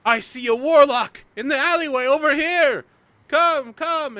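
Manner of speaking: high, loud townsperson's shout; shouting alarm. Acted. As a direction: raise the alarm across the street. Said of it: "you hear someone begin shouting".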